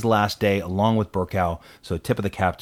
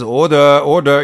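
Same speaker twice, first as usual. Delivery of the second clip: loud, higher Speaker's call to order; raised-voice parliamentary call; 5 semitones higher, 10 dB louder than usual. Retold or imitated. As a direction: imitate the Speaker calling the House to order. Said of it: "if, when he says".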